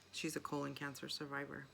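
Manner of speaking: ordinary delivery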